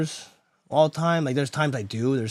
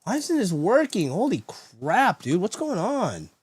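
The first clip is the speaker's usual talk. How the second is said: exasperated whine; 7 semitones higher, slower than usual. Real, not acted. Frustrated at failing stream software.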